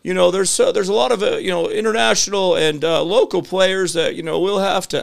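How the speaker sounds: high-pitched, hesitant politician imitation; Bill Clinton. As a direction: waffle through it in a high put-on voice. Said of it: "this is the worst Bill Clinton imitation".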